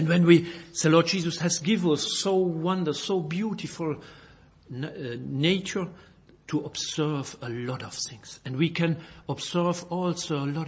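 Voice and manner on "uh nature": ordinary delivery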